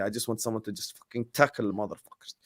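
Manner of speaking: said low-key under the breath; quietly, half to himself. Real, not acted. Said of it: "low-key".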